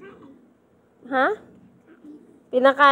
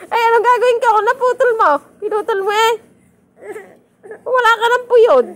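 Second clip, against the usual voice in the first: high, loud exclaiming; amused alarm; 8 semitones higher, 5 dB louder than usual. Real, not acted. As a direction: exclaim loudly in amused dismay. Laughing discovery that child's hair got cut. (gulat na natatawa, mataas ang boses)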